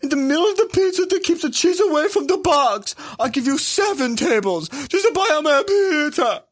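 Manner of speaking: high, hammy pizza-man sales pitch; Papa John. Acted. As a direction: hawk it in a high cartoon voice.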